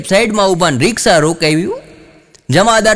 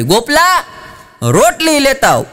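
put-on old man's shout; 10 semitones higher than usual. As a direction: yell across the yard for the boy. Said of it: "બાપાએ રાડ પાડી".

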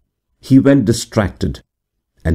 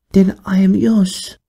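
higher, slow murmured yielding; 13 semitones higher than usual. A narrator's reading. The stranger yielding to Wolfgang, slow high murmur. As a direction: breathe it out slowly, giving herself. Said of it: "murmured she".

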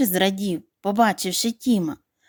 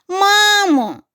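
high, loud cry to his mother; duckling shouting to his mother overhead; 10 semitones higher, 6 dB louder than usual. A narrator's reading. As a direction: cry out with all your voice. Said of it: "закричав Тім".

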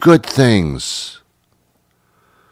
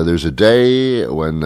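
usual speaking voice

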